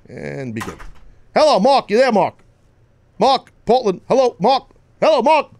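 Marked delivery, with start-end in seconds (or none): 1.3-5.5 high, louder calls of Hello, Mark; calling out. Real, not acted. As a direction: call down the line to a silent caller. Calling dead phone line.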